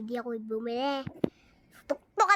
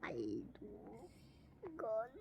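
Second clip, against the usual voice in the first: whisper; 4 semitones lower, 13 dB quieter, much slower than usual. Acted. Whispered word.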